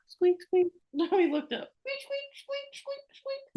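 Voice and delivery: high, squeaky toy sounds; squeaky toy imitation. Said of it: "just the squeak sound".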